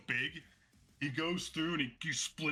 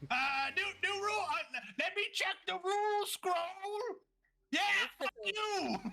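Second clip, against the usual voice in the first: high, squawking indignant protest; outraged fighter. Acted. Josh disputes the ring-out, high-pitched outrage. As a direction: splutter and rage at the ruling. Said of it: "he's like".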